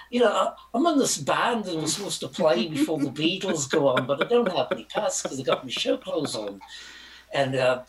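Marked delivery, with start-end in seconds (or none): 0.1-6.6 put-on British accent, pitched up; hammed-up British musician's accent. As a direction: talk your way in with a fake British accent. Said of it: "I put on my best British accent".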